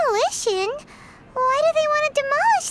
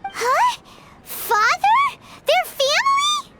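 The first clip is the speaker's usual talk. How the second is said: 깜짝 놀라 훨씬 높고 크게; 믿기지 않는다는 듯 높이 되묻기; shocked disbelief; 9 semitones higher, 4 dB louder, slower than usual. Acted. Stunned to learn they're family.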